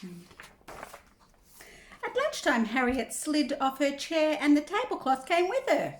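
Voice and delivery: picture-book reading with big pitch swings; reading to children. Acted. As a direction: draw out the key words for young listeners. Reads picture book aloud.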